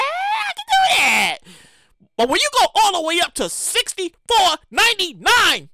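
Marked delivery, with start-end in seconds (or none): 0.0-1.4 high cracking mock cry, drawn out slow; mock anguished wail. Venting about Hulu's price, a hammed-up anguished wail. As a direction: wail it like it hurts.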